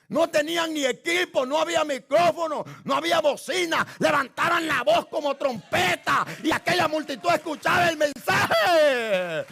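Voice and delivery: loud, rising sermon shout; fiery pulpit shouting; preaching at full force. Acted. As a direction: bellow it like a trumpet over the crowd.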